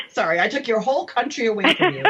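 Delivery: quick words that break into laughter; laughing at her own mix-up, apologising fast; laughing apology. Real, not acted. Host apologising to guest for her slip, laughing.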